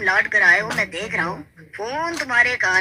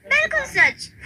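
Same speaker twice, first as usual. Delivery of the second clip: very high sweet promise; eager promise. Acted. (बहुत ऊँची मीठी आवाज़ में वादा)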